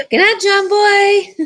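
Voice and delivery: high, loud, slow singsong call; laughing, sung-out call across the room; playful good-night call. Pretend. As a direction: holler a joking good night, drawing it out.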